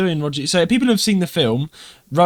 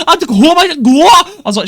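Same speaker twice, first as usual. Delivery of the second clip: much higher, louder mock panic; laughing exaggerated cry; 11 dB louder, faster than usual. Real, not acted. Host joking about breaking up with a Scouse girl, mock panicked. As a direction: yelp it out like fleeing in panic. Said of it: "fleeing Scouse girl".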